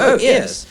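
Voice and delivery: "Oh, ears" said high, as "ears" instead of yes; posh "ears" for yes. Shown as a demonstration. At the golf club, posh Tory saying yes as "ears". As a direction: say "ears" like a home counties Tory. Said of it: "they say ears".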